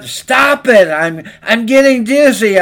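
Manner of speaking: high, loud dizzy shout; acted scream. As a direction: cry out while spinning on the wheel. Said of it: "I screamed".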